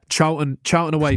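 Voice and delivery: usual speaking voice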